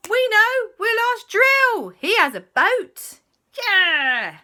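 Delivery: very high, loud eager idea; excited kids. Acted. Kids suddenly remembering Drill's boat, very high and loud. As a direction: blurt the bright idea.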